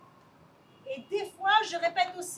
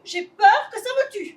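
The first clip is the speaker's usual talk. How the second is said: a touch louder, laughing through tears; nervous laughing repeat; 4 semitones higher, 12 dB louder than usual. Acted. Lily repeats every phrase.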